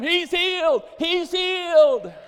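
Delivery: very high, full-volume yell; hammed-up astonished cry, stretched at the end; amazed shouting. Doctor running behind his healed patient, amazed shouting.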